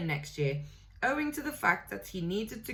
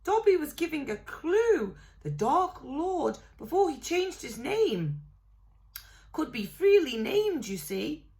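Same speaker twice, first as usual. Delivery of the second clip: high squeaky elf voice, a little louder; earnest elf; 9 semitones higher, 4 dB louder than usual. A narrator's reading. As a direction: patiently explain the obvious. Dobby explaining his clue.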